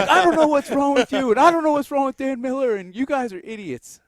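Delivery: dad scolds son about Dan Miller; dad scolding. Retold or imitated. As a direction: rant like an exasperated dad.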